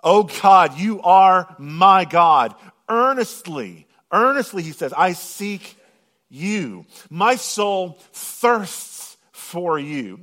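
slow, loud, impassioned psalm reading; fervent scripture reading. Acted. Preacher reading the psalm to his congregation, fervent and slow. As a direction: pray it out with full fervour.

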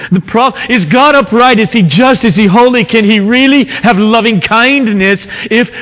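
high, urgent, rising sermon voice; impassioned preaching. Acted. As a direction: press each question harder. Preacher builds the problem of evil.

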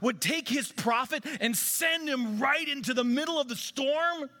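high-pitched incredulous outburst; freaking-out yelling. Retold or imitated. Preacher retelling how he raved at home about Scripture, excited yelling. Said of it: "I'm yelling at the house".

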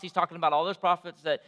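ordinary delivery

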